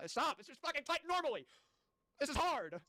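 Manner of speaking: high, loud, fed-up yell; exasperated shouting. Real, not acted. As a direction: cry out in frustration. Exasperated outburst at the text.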